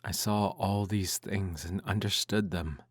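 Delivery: ordinary delivery, nothing marked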